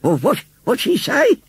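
put-on slow, high old woman's voice; stumbling, bewildered question; confused old lady. A narrator's reading. Missus Kibbins failing to catch the news, confused.

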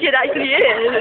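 ordinary talk, nothing marked

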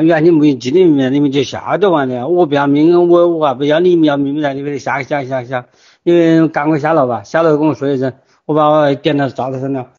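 ordinary talk

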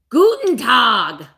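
slow, loud German "Guten Tag" with the "Gu" drawn out; German greeting. Shown as a demonstration. Auctioneer greets her German lobsters with a slow, loud German accent. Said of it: "what else do I know in Ger".

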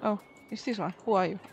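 ordinary talk, nothing marked

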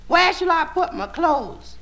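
high, slow put-on lady voice; lady's voice. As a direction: ask it coyly in a high lady voice.

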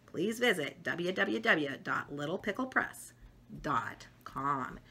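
ordinary delivery

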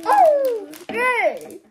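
loud, high squeal of joy; excited cheer. Real, not acted. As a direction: cheer with delight. Child cheers at toy.

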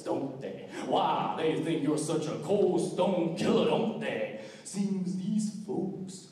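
Rattlesnake Jake. Retold or imitated. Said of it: "the character I'm about to do".

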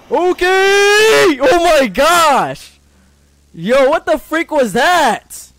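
frightened yelling, high and loud; startled scream. Real, not acted. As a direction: shriek in real fright. Jump scare in horror game.

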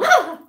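short piercing shriek of fright; startled scream. Retold or imitated. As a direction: shriek as someone bursts in on you in the bath. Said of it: "entonces hace así como".

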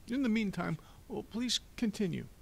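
high-pitched, fussy banker; fussy Mister Poe, dismissive. Acted. Banker postponing the lock discussion, fussy. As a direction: put it off in a high official voice.

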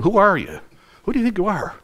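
high, pointed demand, voice jumping up; indignant challenge. Retold or imitated. Temple leaders challenging Jesus, indignant and high. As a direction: confront an upstart who overturned the tables. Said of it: "what are they asking Jesus".

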